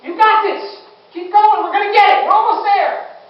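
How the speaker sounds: high, loud urging over the fire; shouted encouragement. Retold or imitated. Senior man urging the rookie through the fire, loud. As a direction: push him on, yelling support.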